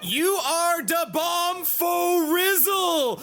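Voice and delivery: hammed-up voice. Reads in-game quote in voice.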